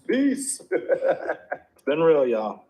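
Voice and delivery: laughing farewell, high and loud. Acted. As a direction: toss out a laughing goodbye. Jokingly ending the stream.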